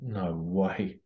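ordinary talk, nothing marked

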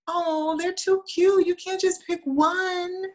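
high slow doting coo; church ladies cooing. Retold or imitated. Church judges fawning over the twins, high and slow. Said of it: "So it was the".

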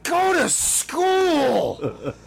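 high, very slow furious shout; fed-up judge losing it; exasperated judge yell. Pretend. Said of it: "I would just go".

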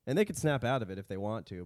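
ordinary talk, nothing marked

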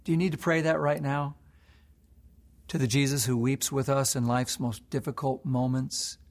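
ordinary delivery

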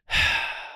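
a breathy, let-down sigh; disappointed sigh. Retold or imitated. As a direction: sigh like a dentist let down by her patient. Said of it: "this sigh where she's like".

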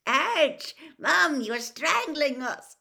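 high, loud, slow complaint; squashed twins. A narrator's reading. Twins to their mother, loud high squashed protest. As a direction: protest while crushed in a hug.

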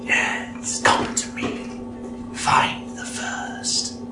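eerie unvoiced whisper; doubled with whisper. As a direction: breathe it like a spirit in the mask. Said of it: "this whispered voice".

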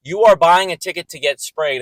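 ordinary delivery

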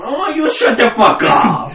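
high, loud mock yell; Uncle Sam yelling. Uncle Sam patting heads, yelling loud and high. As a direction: bellow it like a bullying giant.

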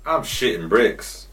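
usual speaking voice

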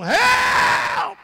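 long, very high shout for help; drawn-out holler for rescue; plaintive yell. Acted. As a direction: cry out at full voice. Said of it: "hears that plaintive call".

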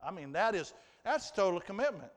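ordinary delivery

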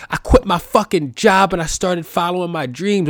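high, loud, proud mock fan; inspired fan. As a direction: announce it like a life changed. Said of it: "they're gonna say cool shit like".

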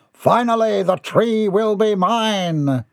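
high, drawn-out gloating cry; high-pitched theatrical triumph; triumphant. Acted. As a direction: proclaim victory, stretching it out. Triumphant exclamation over the tree.

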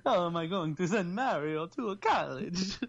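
put-on slow, high Italian accent. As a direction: lament it like an Italian dad. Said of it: "I don't know why he's Italian".

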